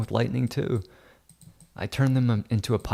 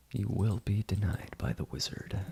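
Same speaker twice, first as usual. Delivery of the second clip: hushed mock wizard; 7 dB quieter than usual. Editor playing wizard repeats refusal to Amy, hushed. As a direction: repeat the decree softly, as a mock threat.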